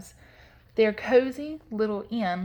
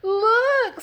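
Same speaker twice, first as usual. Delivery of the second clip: very high, loud squeal; Little Honey; 8 dB louder than usual. A narrator's reading. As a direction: shriek it as a little cub. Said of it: "squealed Little Honey".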